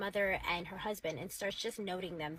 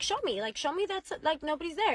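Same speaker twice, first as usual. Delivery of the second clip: higher-pitched insistent demand; retold freak-out; 6 semitones higher than usual. Wife demanding to see nobody's there, retold freak-out. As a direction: press him urgently, mildly frantic. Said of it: "She freaks out".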